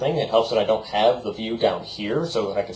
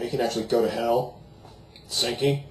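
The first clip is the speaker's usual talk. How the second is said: usual speaking voice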